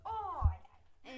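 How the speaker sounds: slow, loud, high wail. Real, not acted. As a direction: moan out the loss. Dies in game, wails.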